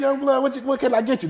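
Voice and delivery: high, lightly voiced old man; a hint of an old street hustler. Voicing old Uncle Sonny.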